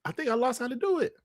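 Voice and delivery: half-remembered J-Tech; J-Tech impression. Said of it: "this is J-Tech".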